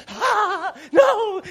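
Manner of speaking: high mock scream of a scared child; panicked kid. Son lifted over dad's head, squealing in mock panic.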